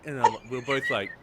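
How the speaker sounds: ordinary talk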